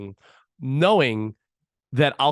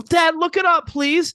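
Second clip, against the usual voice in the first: much higher, louder imitated kid; pleading child; 5 dB louder, slower than usual. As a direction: beg Dad like an insistent little girl. Father voices his daughter's plea.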